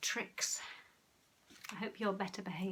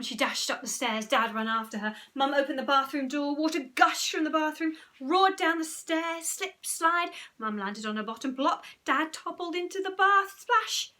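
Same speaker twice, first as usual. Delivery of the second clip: higher, louder, breathless storytelling; excited narration; 6 semitones higher, 8 dB louder than usual. A narrator's reading. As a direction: race through the flood scene.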